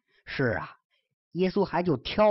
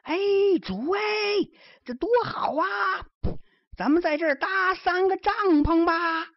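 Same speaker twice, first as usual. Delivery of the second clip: shouting at the top of his voice; 14 semitones higher, faster than usual. Acted. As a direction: yell it out, overwhelmed by the vision. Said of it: "扯着嗓子喊". (扯着嗓子激动地高喊)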